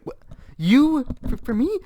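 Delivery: loud, high, stammered disbelief; flustered. Retold or imitated. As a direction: imitate someone flustered and caught off guard. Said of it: "she's flustered".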